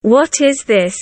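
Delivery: ordinary talk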